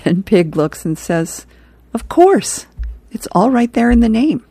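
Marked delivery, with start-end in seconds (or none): 1.9-4.4 a goofy, wide-eyed realization; Pig's realization. Acted. Pig answers Rat about the web, in a high dopey voice.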